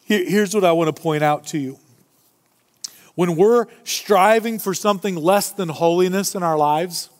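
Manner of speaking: usual speaking voice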